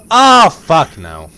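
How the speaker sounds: slow, loud horrified groan; scared shout. Real, not acted. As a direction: drag it out in dread. Another scare in game.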